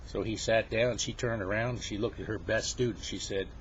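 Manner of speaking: plain reading voice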